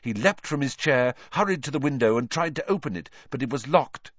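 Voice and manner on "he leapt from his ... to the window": ordinary delivery, nothing marked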